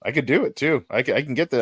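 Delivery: normal talking voice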